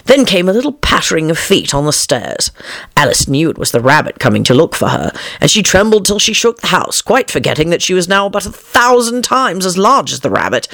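usual speaking voice

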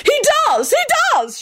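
very high frantic shriek; wild shriek. A narrator's reading. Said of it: "she shrieked wildly".

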